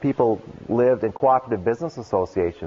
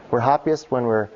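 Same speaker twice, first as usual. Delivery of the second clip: normal talking voice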